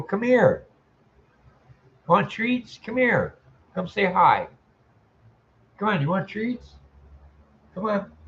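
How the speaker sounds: high sing-song pet voice; calling a cat in a high, sweet pet voice; coaxing the cat. Real, not acted. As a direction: coax the cat over with treats.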